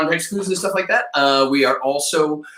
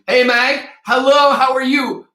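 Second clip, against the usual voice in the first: excited greeting; 11 semitones higher, 4 dB louder than usual. Real, not acted. Greeting a student joining call.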